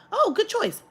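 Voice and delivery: high, bright fake-friendly clerk; sugary clerk. Mocking the clerk's cheery greeting, high and bright. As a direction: chirp it like a fake-nice salesman. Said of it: "he did not".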